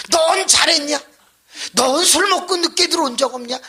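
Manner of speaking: high, loud comeback; combative retort. Retold or imitated. Wife fighting back at her scolding husband, high loud retort. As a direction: imitate a wife hitting straight back. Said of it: "맞서서 같이".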